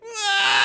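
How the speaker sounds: high mock wail of a tired child; imitated child cry. Imitating kids fighting over a scooter, mock wail. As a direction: wail like a kid bursting into tears. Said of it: "cause them to go like".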